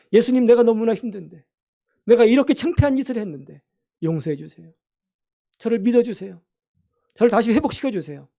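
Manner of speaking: pleading prayer. Acted. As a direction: beg for forgiveness with your whole heart. Example prayer of a struggling child. (간절하게 외치는 기도)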